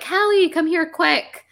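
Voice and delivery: high, slow calling out. Retold or imitated. Scarlet calling Hallie across the street, high and slow. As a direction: call her over in a high voice. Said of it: "she's just like".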